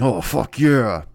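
slow, drawn-out mock cry of pleasure; mock lone yelling. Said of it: "now I'm just alone yelling".

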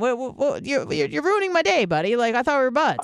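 high whiny wounded protest; mock hurt. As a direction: whine like a betrayed friend. Mock-hurt complaint to caller.

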